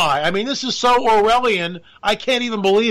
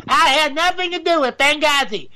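"I had nothing to do with Benghazi" pitched high in a put-on woman's voice; a high, hammed-up Hillary; Hillary Clinton; 8 semitones higher than usual. Shown as a demonstration. Host retrying a Hillary impression, high put-on voice. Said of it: "I can't do a Hillary Clinton".

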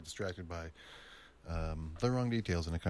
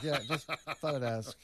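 laughing; 5 semitones higher than usual. Retold or imitated. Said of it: "I'm like".

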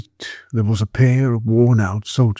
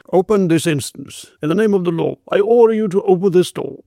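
raised, higher-pitched police command; stern official order; 6 semitones higher than usual. A narrator's reading. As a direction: demand entry in the name of the law. Constable orders woman to open up.

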